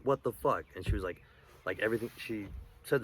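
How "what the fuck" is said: sudden raised "what the fuck", higher and louder. Retold or imitated. Arguing with his abusive girlfriend, raised voice. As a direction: snap back at her in a retold outburst. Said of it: "I raised my voice at her".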